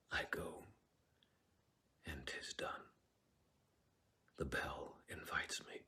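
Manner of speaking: a hushed, breathless whisper; stage whisper. Acted. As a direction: whisper it like a secret to yourself. Soliloquy continues in whisper.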